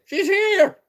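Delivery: sudden high, loud shout of greeting; squealing welcome to a friend who just joined; excited shout. Real, not acted. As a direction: yell out with delight as a friend arrives. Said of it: "Sorry, I got excited".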